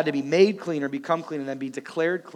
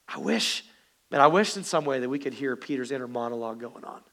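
usual speaking voice

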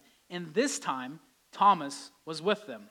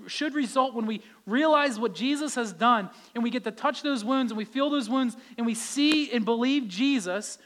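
louder, driving sermon; fervent preaching; 4 semitones higher, 5 dB louder than usual. Acted. Preacher urging the congregation, louder and driving. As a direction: preach it with conviction.

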